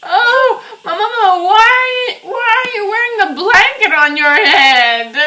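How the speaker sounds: loud, high, laughing alarm; frantic half-laughing questions; mother bursting in, loud and alarmed. Acted. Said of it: "Oh, calm down".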